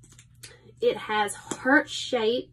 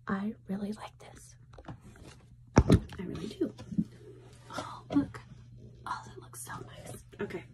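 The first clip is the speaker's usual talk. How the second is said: whispering; 9 dB quieter than usual. Real, not acted. Admiring lashes under her breath.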